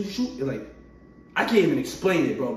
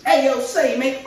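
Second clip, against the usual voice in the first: mock British accent; 9 semitones higher, 6 dB louder than usual. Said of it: "why am I thinking about British?".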